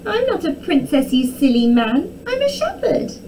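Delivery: high, cross princess retort; snapped. A narrator's reading. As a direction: snap indignantly at the silly man. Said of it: "Arabella snapped".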